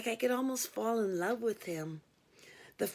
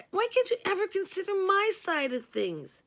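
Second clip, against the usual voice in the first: high, pleading complaint; rising frustrated outburst; frustrated plea; 10 semitones higher than usual. A narrator's reading.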